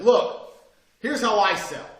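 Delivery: half-shouted sales pitch at the boss's meeting; half yelling. Retold or imitated. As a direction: take over the room and tell them. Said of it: "I was half yelling".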